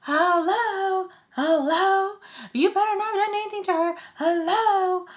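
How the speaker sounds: loud, high-pitched frantic hello; re-enacted shrieking demand; imitating her screaming. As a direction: mimic someone screaming down the phone. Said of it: "she just keeps screaming".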